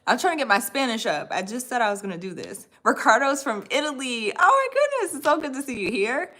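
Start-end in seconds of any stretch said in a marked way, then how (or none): none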